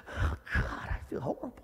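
hushed, voiceless aside to God; very soft, breath-only lament; miserable whisper. Acted. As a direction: whisper it as a private groan of misery. Acts out private misery during worship.

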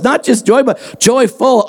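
normal talking voice

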